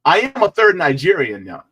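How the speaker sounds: loud, high, joking declaration; playful insistence. Real, not acted. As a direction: proclaim it brightly in your own accent. Joking aside between accent takes.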